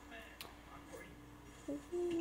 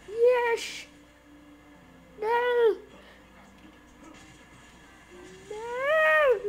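high, loud one-word shouts; excited yelling; 9 semitones higher, 6 dB louder, much slower than usual. Real, not acted. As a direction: shout at the game in triumph, then dismay. Wins, then loses the car.

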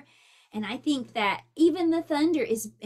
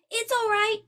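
much higher, louder reassurance; shouting back; 10 semitones higher, 5 dB louder than usual. Acted. Fox answering his mother from the treehouse, shouting. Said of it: "Fox shouted back".

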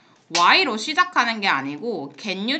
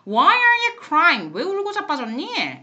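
mock scolding; 7 semitones higher, 4 dB louder than usual.

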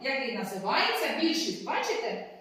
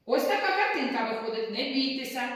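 ordinary talk, nothing marked

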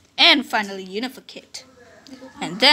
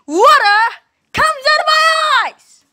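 much higher, louder, breaking; voice cracking; 14 semitones higher, 7 dB louder than usual. Acted. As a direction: squeak it out tearfully. Commander denies crying, voice breaking.

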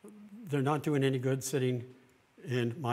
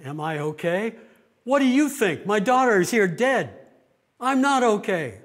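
much higher and louder, anguished shouting; grieving mother's outburst; 8 semitones higher, 11 dB louder than usual. Retold or imitated. Bereaved mother shouting back at the chaplain, anguished fury. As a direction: hurl it back in raw grief and anger. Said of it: "she turned and looked at me, and she said".

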